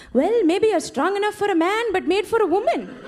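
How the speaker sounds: the mother chirping a commercial slogan; mom's Indian accent. Retold or imitated. Mom quotes commercial.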